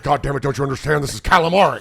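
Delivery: loud exasperated outburst, rising on calamari; angry yell. Retold or imitated. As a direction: bark it out like an irate customer. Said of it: "He said".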